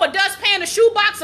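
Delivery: ordinary delivery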